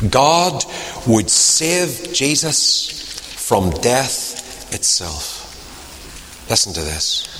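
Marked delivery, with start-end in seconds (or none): 0.1-5.4 slow solemn emphasis. Acted. Preacher repeats key line for emphasis.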